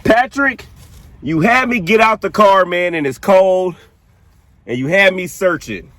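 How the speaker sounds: high, teasing call to the ghost; calling out, exasperated. Real, not acted.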